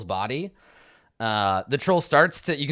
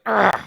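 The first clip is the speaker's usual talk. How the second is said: loud, higher snarling grunt; 8 semitones higher, 8 dB louder than usual. Acted. Durnan snarls at the troll biting him, snarling grunt. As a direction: snarl through the pain. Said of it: "he just snarls".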